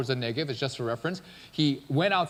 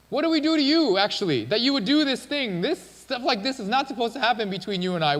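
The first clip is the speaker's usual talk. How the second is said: higher, acted outraged protest; indignant confrontation; 7 semitones higher, 3 dB louder than usual. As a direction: demand an explanation, mock indignant. Acting Abimelech confronting Abraham.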